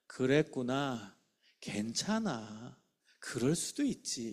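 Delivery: slow, soft and reassuring; accepting. Shown as a demonstration. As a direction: soothe a troubled child gently. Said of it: "이게 수용적 언어예요". (아이를 달래듯 천천히 부드럽게)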